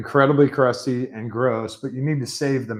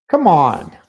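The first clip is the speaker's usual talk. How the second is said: louder frustrated come on; venting at the spill out loud; exasperated; 4 semitones higher, 9 dB louder than usual. Real, not acted. Fed up with the spilled energy drink, louder exasperated outburst.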